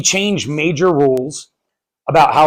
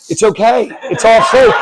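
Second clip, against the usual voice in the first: high, louder acted comforting over chaos; imitating panicked parents soothing kids; frantic reassurance; 6 semitones higher, 4 dB louder than usual. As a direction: reassure them, voice pitched up and strained. Parents comforting sobbing kids amid screaming.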